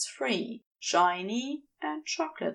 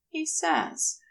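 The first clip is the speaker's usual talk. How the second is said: usual speaking voice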